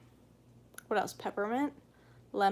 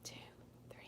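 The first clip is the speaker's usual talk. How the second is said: counting under breath; 13 semitones lower, 21 dB quieter, faster than usual. Shown as a demonstration. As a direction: count gently like putting a child to sleep. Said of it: "Whisper count".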